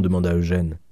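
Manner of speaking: ordinary delivery, nothing marked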